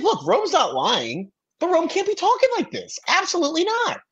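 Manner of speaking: high-pitched, emphatic imitation of a castmate; Genevieve. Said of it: "She's like".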